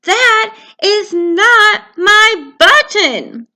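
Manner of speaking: hammed-up wailing Toad. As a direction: wail it out. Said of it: "wailed Toad".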